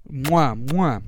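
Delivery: two smacking blown kisses; between kisses. Real, not acted. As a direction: blow kisses into the mic. Signing off to listeners.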